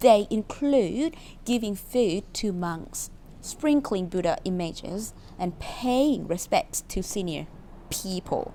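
very slow, word-by-word English reading; careful dictation pace; slow reading aloud. Shown as a demonstration. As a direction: read each word out slowly for learners. Teacher reads sentence aloud.

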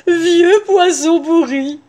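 slow, high, syrupy wolf; sugary sweetness. A narrator's reading. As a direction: savour each word with exaggerated sweetness. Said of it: "prit sa voix la plus douce".

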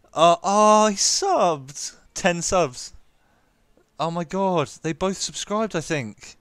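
high, loud amazement; delighted surprise. Real, not acted. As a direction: react amazed at the new subscribers. Surprised by new subscribers.

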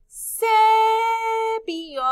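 "cepillo" with a long, high, loud CE; stress on first syllable. Shown as a demonstration. Teacher reveals where the C is, stretching ce in "cepillo". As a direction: call out ceee-pillo, stretching the start. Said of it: "Aquí en la primera sílaba".

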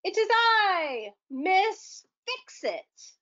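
high, slow heroic proclamation; grand hero announcement. Acted. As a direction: announce yourself like a superhero.

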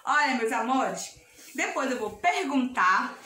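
normal talking voice

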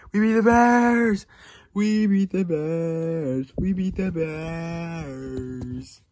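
high, slow celebratory cry, stretched out long; drawn-out victory cheer. Real, not acted. Fan celebrates a win over the Bears, long drawn-out cheer. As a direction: stretch each word out in triumph.

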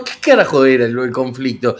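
ordinary delivery

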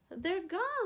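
high, loud wail. A narrator's reading. Lulu wailing to Mama that the monkeys vanished. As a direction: cry it out in dismay. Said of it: "Lulu wails".

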